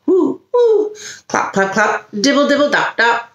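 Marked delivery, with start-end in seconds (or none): none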